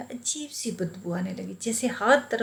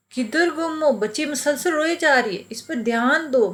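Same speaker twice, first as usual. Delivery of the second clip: louder and higher, pressing his wife; raised urgent call; 6 semitones higher, 4 dB louder than usual. Retold or imitated. Husband calling distracted wife to tend crying baby, raised urgent voice. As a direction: snap her out of her daze, voice up.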